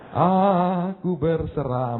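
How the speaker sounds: slow, drawn-out mock prayer; a touch of hammed-up surrender. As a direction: stretch it like a reluctant confession. Mock wife's altar call.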